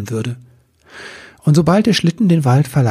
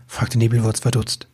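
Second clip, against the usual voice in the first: plain reading voice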